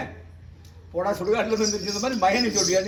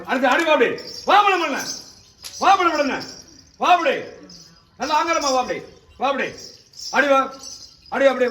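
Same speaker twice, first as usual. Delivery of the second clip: higher, louder calls of வா; commanding shout; 3 semitones higher, 3 dB louder than usual. Real, not acted. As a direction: summon the spirit forcefully. Exorcist commands spirit to come.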